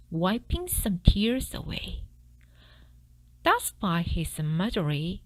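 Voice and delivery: ordinary delivery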